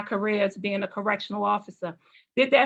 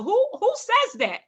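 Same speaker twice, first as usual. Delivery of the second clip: high-pitched rhetorical disbelief; incredulous; 11 semitones higher than usual. Acted. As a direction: ask it as if nobody would ever say such a thing. Rhetorical disbelief at her own ambition.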